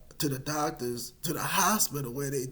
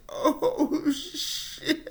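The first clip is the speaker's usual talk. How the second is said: high breathy laugh through oh shit; half-laughed curse at the news; laughing shock; 13 semitones higher than usual. Retold or imitated. Remembering the diagnosis hitting him, laughing.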